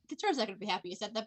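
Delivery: fast little note to self; inner thought aloud. Acted. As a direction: rattle it off under the sentence like a private thought. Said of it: "in my head, I'm like".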